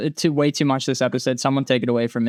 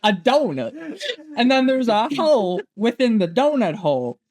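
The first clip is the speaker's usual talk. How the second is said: put-on Southern accent; 8 semitones higher than usual. Fan quoting the movie detective's donut speech, imitated Southern accent. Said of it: "it's because of the accent".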